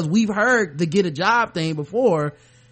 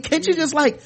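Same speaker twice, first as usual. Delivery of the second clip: mock complaining; 8 semitones higher, 3 dB louder than usual. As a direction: exaggerate the put-upon bafflement. Mock complaint continues, higher and louder.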